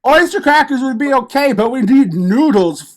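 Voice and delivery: loud, fast angry rant; angry chef yell. Acted. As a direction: bellow at the fool. Chef berating the waiter.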